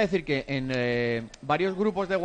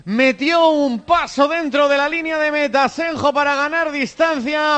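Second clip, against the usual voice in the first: high, chanting football commentary; radio commentator narrating a chance as it happens; live play-by-play; 9 semitones higher, 8 dB louder, slower than usual. Real, not acted. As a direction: call the action with drawn-out, sustained high notes.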